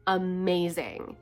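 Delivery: normal talking voice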